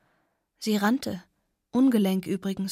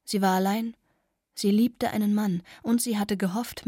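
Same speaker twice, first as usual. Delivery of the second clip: plain reading voice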